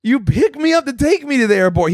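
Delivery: high-pitched incredulous protest; retold exasperation. Passenger reminding the Uber driver of the job, mock exasperated.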